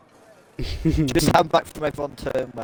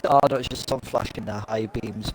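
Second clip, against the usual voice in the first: ordinary talk, nothing marked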